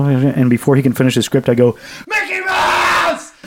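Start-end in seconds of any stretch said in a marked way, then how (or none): 2.1-3.3 high drawn-out scream of the name; scream into the phone. Retold or imitated. Caller screaming a name into a scam call, high and slow. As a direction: shriek it as loud as you can. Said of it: "I scream it into the phone as loud as I can".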